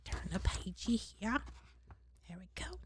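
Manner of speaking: murmured aside to self; soft, half-whispered asides; quiet aside. Real, not acted. Aside while turning page.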